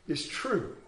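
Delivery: usual speaking voice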